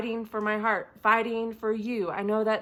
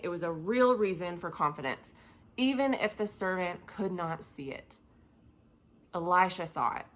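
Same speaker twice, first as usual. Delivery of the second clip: ordinary talk, nothing marked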